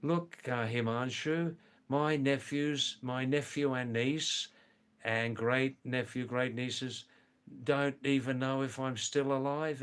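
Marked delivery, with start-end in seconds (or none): none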